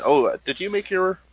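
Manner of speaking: ordinary talk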